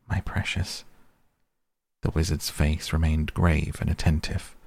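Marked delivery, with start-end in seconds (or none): 0.1-0.8 creepy whispered hiss of precious; Gollum-like whisper. A narrator's reading. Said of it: "It has been called that before".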